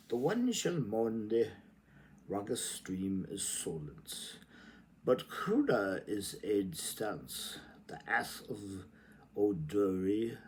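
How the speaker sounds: slow, lilting recital of Joyce; poetic reading. Acted. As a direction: read it like an incantation. Reads Joyce aloud.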